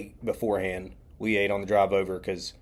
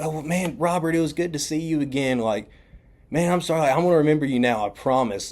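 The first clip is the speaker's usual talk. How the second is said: higher-pitched imitated promise; earnest apology; 6 semitones higher than usual. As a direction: act out an eager-to-make-amends goodbye.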